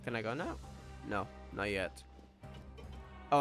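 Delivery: ordinary talk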